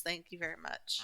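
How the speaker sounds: low, soft mock-sarcastic thanks. Voicing the game answering her back, sarcastic. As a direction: drop it quietly, dripping mock gratitude. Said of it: "Don't you get sarcastic with me".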